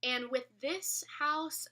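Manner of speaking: normal talking voice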